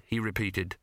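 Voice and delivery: usual speaking voice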